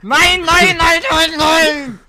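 high, loud repeated no; yelling no after a friend crosses a line; horrified shout. Real, not acted. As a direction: cry out in mock horror. Horrified protest at a slur.

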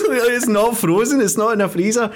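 teasing a friend's amazement about fresh fruit; mock amazed. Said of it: "you were like".

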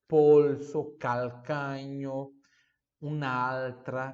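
slow words with the L drawn out; strongly sounded Italian L. Shown as a demonstration. Teacher demonstrating L for Portuguese speakers, long clear L. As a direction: stretch each L in polso, calcanho, altra. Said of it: "veja esse L".

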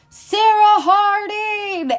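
loud, high, drawn-out full-name holler; Vince Vaughn. Retold or imitated. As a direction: imitate a searcher calling slowly into the distance. Acts out Vince Vaughn's search call.

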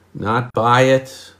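ordinary talk

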